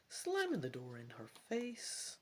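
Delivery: ordinary delivery, nothing marked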